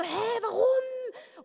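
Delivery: baffled demand; mock baffled friends; puzzled. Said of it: "die dann so".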